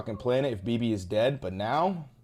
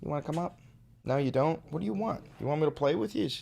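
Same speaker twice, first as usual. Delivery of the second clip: pet talk; 5 semitones higher, 4 dB quieter, faster than usual. Real, not acted. Talking to his pet.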